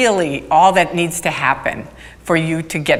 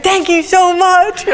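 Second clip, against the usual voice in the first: high, slow, laughing thank you; fleeing recruit thanking her, laughing; excited thanks; 12 semitones higher, slower than usual. Retold or imitated. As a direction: gush thanks while running off. Grateful guy running off.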